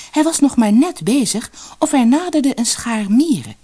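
ordinary delivery

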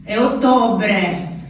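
ordinary talk, nothing marked

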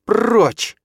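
loud, high-pitched command; venomous. A narrator's reading. Stepmother driving Числав away from the baby, venomous. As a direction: drive him away with one furious burst. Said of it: "зашипела на Числава".

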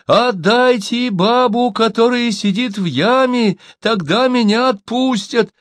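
high, drawn-out calling; storyteller voicing a long-distance shout; shouting across. A narrator's reading. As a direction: call it slowly to people behind a fence. Shouting to the fort.

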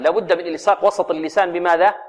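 usual speaking voice

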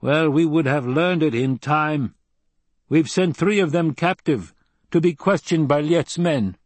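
high-pitched, matter-of-fact tribesman voice; Fremen desert fighter, blunt. A narrator's reading.

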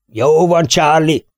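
high-pitched farmyard holler; bellowed order. A narrator's reading. Lord shouting to his man Charlie, bellowing. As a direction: bellow it out to a farmhand. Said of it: "üvöltötte".

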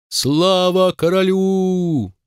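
high, slow shouted hail; shouted cheer. A narrator's reading. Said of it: "дружно выкрикнули".

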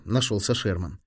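ordinary delivery, nothing marked

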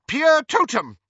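high, loud incantation; spell shout. Acted. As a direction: cry out the spell. Casting a spell loudly.

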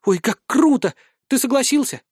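high excited squeal of delight; giddy party girl. Acted. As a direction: gush with girlish excitement. Girl thrilled by invitation.

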